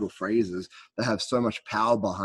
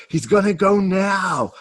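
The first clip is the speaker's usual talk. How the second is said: excited; 8 semitones higher, 7 dB louder than usual. Retold or imitated. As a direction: yell it out like you can't believe it's about to blow. Listener reliving tense moment excitedly.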